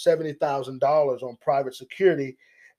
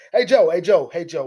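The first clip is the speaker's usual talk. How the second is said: loud, higher call for Joe's attention; imagined calling out; 4 semitones higher, 8 dB louder than usual. Retold or imitated. The man imagined calling out to Joe, loudly. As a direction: hail Joe, loud and insistent. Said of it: "He pretty much was thinking".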